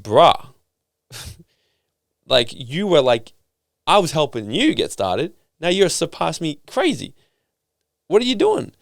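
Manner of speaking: retold amazed friend, rising high; playful disbelief at his success; mock amazed.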